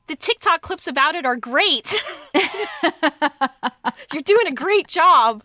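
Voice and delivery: lightly hammed-up fake praise; over-bright polite gushing; fake enthusiasm. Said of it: "and I'm like".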